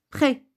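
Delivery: prêt said 'prè', ending on the vowel; silent final S. Shown as a demonstration. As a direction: end prêt on an open è, no s. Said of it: "vous n'avez pas écouté S".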